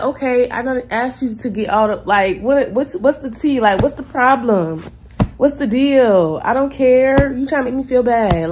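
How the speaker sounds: loud, high, offended comeback; annoyed high-pitched "what's the tea"; offended retort. Retold or imitated. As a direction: fire back at the waiter, insulted. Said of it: "I did take offense to that".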